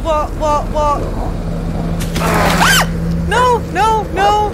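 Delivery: panicked yelps; frightened screaming. Real, not acted. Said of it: "I'm not gonna scream again".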